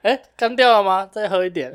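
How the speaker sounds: loud, high-pitched jokey coaxing; playful mock offer. Joking to a pretend crying friend, playful mock offer. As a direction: push a drink on them, hammed-up and high.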